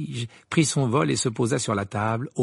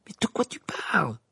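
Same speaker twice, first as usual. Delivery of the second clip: higher, half-voiced bewilderment; astonished Ron; 5 semitones higher than usual. A narrator's reading. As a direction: ask in bafflement. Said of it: "s'étonna Ron". (étonné)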